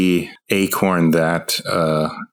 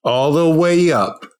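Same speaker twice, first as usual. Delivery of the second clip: hype announcer; 10 semitones higher, slower than usual. Pretend. Sung catchphrase, [name] line.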